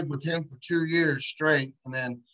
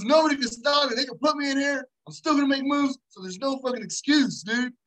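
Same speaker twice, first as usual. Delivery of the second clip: cocky inmate boast; 7 semitones higher than usual. Retold or imitated. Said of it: "He goes".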